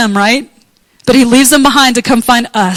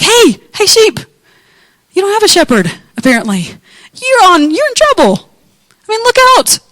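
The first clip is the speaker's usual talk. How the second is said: calling out; 8 semitones higher than usual. Pretend. Calling out to stray sheep.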